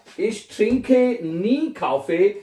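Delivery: Ich komme nie zu spät, each word drawn out very slowly; slow dictation. Shown as a demonstration. Teacher dictating a German sentence to learners. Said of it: "तो क्या बनेगा".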